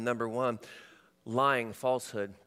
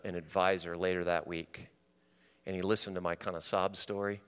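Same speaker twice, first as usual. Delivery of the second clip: ordinary talk, nothing marked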